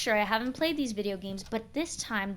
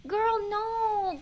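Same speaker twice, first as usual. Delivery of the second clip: high dismissive; 11 semitones higher than usual. Real, not acted. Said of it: "repeated lower".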